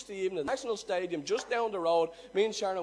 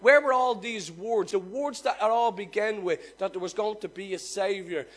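louder, driving preacher voice; fervent preaching; 5 dB louder than usual. Acted. Preacher telling the congregation of God's silence, fervent and loud. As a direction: build the silence of God for them.

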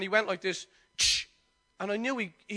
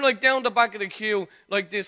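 ordinary delivery

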